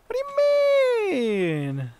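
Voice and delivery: high, very slow drawn-out whine; high mock whine. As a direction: stretch it out in a high squeak. Repeated mock innocence.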